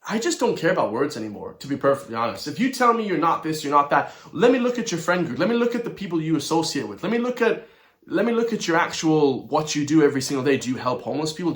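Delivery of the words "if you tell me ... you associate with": normal talking voice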